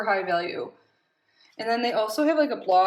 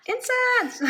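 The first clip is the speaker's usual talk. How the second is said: gasp, then a high thrilled cry of the word; thrilled discovery; delighted gasp; 10 semitones higher than usual. Real, not acted. Gasps at incense in box.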